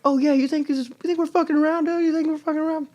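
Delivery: mock the aggressive big guy; threatening tough guy. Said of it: "And this guy's like".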